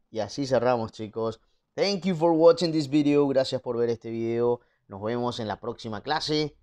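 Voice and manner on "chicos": usual speaking voice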